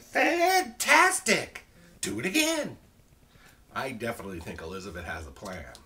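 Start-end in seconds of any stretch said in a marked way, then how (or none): 0.0-2.8 high, drawn-out, gleeful yell; excited shout. Acted. Elizabeth urges the tired dragon on, shouting. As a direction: shout it with huge enthusiasm, way up high. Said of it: "but Elizabeth shouted".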